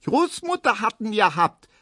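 put-on high, piping child voice; piping girl. A narrator's reading. Erna blaming grandmother in a high piping voice. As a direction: pipe it up like a small girl. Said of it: "piepst Erna".

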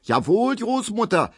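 high, screeching sarcastic retort; shrieking woman. A narrator's reading. Frau Ziebland shrieking at grandmother over the umbrella. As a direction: shriek back at the old woman. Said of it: "kreischt Frau Ziebland".

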